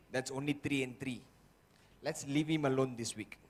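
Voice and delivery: low, hushed plotting voice; a lightly voiced sly demon whispering plans; quiet conspiring inner voice; scheming inner voice.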